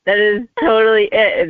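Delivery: giggling through the words; laughing. Real, not acted. Author laughing at herself for forgetting her own character.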